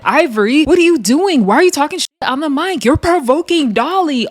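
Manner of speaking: high-pitched acted telling-off; mock scolding. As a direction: call someone out in pretend outrage. Acted scolding nobody gave Ivory.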